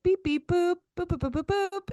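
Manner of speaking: high, sing-song beep boop noises; mock keypad beeps. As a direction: imitate pressing phone buttons. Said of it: "I'll just type numbers like".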